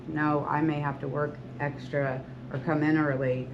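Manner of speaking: ordinary talk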